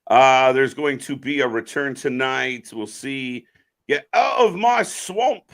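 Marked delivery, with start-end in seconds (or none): 3.9-5.4 Shrek voice. Retold or imitated. Shrek quote, references named after.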